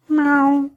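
high mewing meow; cat meow. A narrator's reading. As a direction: mew like a kitten. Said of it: "кто-то сказал". (кошачье мяуканье)

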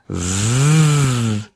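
angry buzzing zzz; bee buzz. A narrator's reading. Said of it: "прожужжала обиженная пчела". (жужжание пчелы)

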